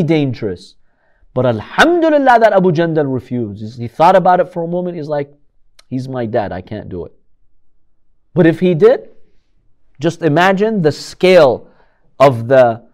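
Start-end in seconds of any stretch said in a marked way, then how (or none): 5.9-7.1 quieter, lower and trailing off; soft refusal. Retold or imitated. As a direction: say it with a heavy quiet heart. Son refusing to kill father.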